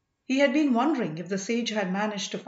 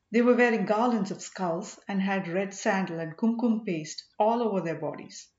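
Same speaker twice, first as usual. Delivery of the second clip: ordinary delivery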